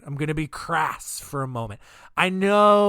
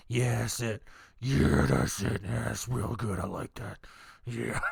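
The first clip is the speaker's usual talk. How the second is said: low, soft, creepy coaxing murmur; sleazy cult leader; 5 semitones lower, 3 dB quieter than usual. Retold or imitated. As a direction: purr it like a creep egging them on. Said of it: "he was just like".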